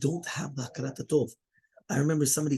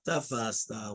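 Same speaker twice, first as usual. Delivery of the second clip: slow recitation; 3 dB louder, much slower than usual. Shown as a demonstration. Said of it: "the Gemara answers".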